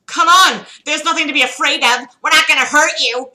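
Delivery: high, loud, fast reassurance; urgent loud reassurance. Acted. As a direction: urge the victim to calm down loudly. Psycho girl calming panicking victim.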